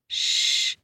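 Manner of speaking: unvoiced hushing shh; whispered shh. Blind man hushes his brother near the lion, whispering. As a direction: hush him in a whisper. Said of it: "he whispered".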